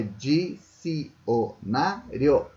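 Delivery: dicionário slowly as di-ci-o-na-rio, final io joined; dicionário in separate beats, the last one rio; syllable by syllable. Shown as a demonstration. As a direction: spell out dicionário in five slow syllables. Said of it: "eles formam um ditongo e não se separam".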